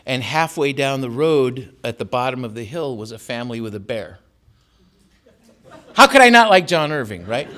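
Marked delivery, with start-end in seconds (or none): 5.9-7.5 high and loud excited aside; excited exclamation. Real, not acted. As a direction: burst out with delight.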